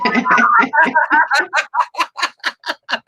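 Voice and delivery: bright, amused laugh. Real, not acted. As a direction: laugh at your own hype. Laughs introducing guest.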